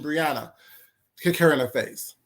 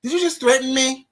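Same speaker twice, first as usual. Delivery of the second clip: ordinary delivery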